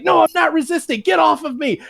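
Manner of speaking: high, panicky pleading; whiny mock plea. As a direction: beg like a man being cuffed. Agent struggling.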